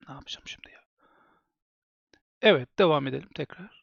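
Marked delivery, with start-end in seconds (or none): 0.1-0.8 desperate whisper under breath. Real, not acted. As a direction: breathe it out, voiceless, lost. Streamer despairs alone. (çaresiz fısıltı)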